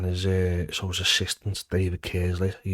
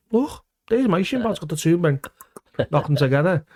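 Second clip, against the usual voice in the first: cheeky taunt; 9 semitones higher, 3 dB louder than usual. Retold or imitated. Cheekily showing shin pads.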